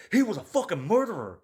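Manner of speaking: loud, high-pitched exasperated rebuttal; indignant outburst. Real, not acted. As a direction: hit back in outraged disbelief. Indignant rebuttal to killer's admirers.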